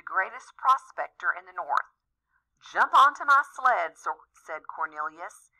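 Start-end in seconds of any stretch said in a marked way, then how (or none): 2.6-4.0 loud, high hearty call. Acted. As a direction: call it out boldly. Prospector calling out in escape.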